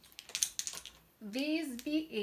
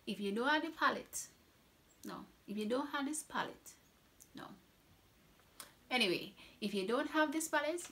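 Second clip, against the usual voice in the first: put-on playful Trinidadian accent, higher and louder; Trini accent; 5 semitones higher, 4 dB louder than usual. Beauty vlogger urging viewers in a Trini accent. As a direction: try out a Trini accent on the line. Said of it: "Let me try to find my Trini accent".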